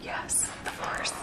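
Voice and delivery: hushed whisper, barely voiced. Real, not acted. Mom whispering back to her Yoda toy, hushed. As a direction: breathe it back like a secret.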